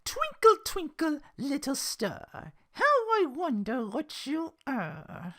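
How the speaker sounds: slow, drawn out menacing sing-song, Twinkle punched loud; pernicious recital. Shown as a demonstration. Reader retrying Belle's pernicious tone, slow and menacing. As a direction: drag each word out spitefully. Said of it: "am I doing this right?".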